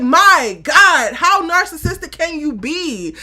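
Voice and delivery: loud, incredulous retort at a selfish cast member; exasperated outburst. Retold or imitated. Host venting loudly at Simone's self-centred behaviour. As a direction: throw up your hands and call her out. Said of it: "I was just like".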